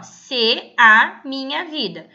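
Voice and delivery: teacher slowly reading a verse line; slow demo reading. Shown as a demonstration. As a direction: spell out the phrase slowly and clearly. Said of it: "Quando eu vou declamar esse poema".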